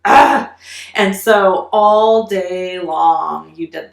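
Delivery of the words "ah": a high, loud short cry of frustration; frustrated yell. Retold or imitated. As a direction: let out a burst of kid disappointment.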